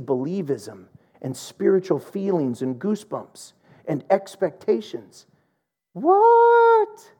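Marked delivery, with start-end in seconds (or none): 5.9-7.1 high, loud squeaked disbelief; a shocked, much higher yelp; preacher's mock shock at the congregation; incredulous what. Mock disbelief at easy believism.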